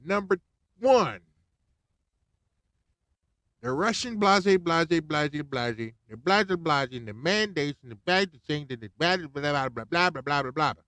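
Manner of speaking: loud, high mock rambling old man; mocking Biden. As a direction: ham up a confused politician blabbering. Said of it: "He gonna bust out and say".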